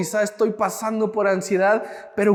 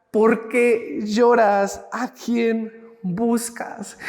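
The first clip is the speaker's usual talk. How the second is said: tender question; much slower than usual. Acted. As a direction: ask it slowly, tenderly, as to a grieving friend. Jesús pregunta tiernamente a María. (pregunta lenta y tierna, con mucha suavidad)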